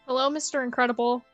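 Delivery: ordinary delivery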